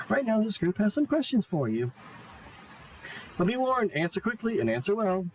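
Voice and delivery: high, bubbly game host voice; high character voice. Acted. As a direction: play the cheery host character.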